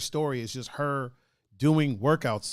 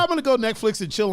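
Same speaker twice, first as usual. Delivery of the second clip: innocent quote; 7 semitones higher, 4 dB louder than usual. Retold or imitated. Quoting Melody innocently.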